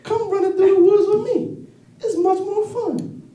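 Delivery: high, slow cartoon rabbit pleading; squeaky little rabbit coaxing. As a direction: chipper high-pitched bunny. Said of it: "he said".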